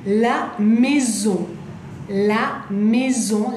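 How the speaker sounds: "la maison" drawn out very slowly; slow French model. Shown as a demonstration. Teacher modelling la casa in French, very slow. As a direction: stretch each syllable of "la maison".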